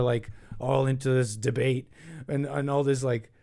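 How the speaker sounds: ordinary delivery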